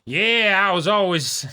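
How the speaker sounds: eager convert. Retold or imitated. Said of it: "that one dude on Parody that's like".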